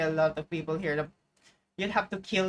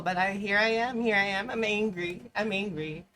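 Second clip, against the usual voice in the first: ordinary delivery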